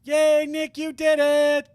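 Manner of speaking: put-on high, loud cheering girl voice; excited cheer. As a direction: burst out cheering for the win. Cheering after a won trial.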